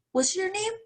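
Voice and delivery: put-on high, squinting old man's question; lightly voiced crusty old man, puzzled; old man asking. Old man at the register asks her name, puzzled.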